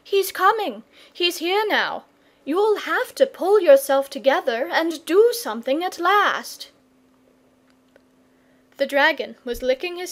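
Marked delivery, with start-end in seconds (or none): 0.0-6.7 put-on breathless, higher boy's voice shouting news; excited boy. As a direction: burst in with urgent news.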